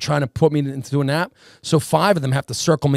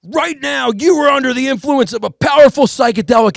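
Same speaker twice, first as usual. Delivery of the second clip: high, loud lecturing yell; mock yelling cop; 9 semitones higher, 5 dB louder than usual. Cop friend yelling at the tripping guest, high and loud. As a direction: bark it like a self-important officer. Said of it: "starts yelling at me".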